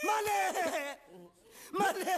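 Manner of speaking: high, drawn-out wail for the master; wailing cry. Acted. Servant calling to the master leaving him behind, wailing.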